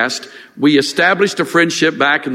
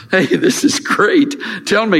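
happy excitement; 6 semitones higher than usual. Retold or imitated. Pastor retelling his reply to the good news, delighted. Hearing friend is cancer-free.

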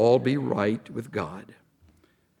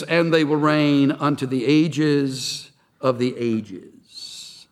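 slow solemn reading; much slower than usual. Shown as a demonstration. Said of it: "The Greek says".

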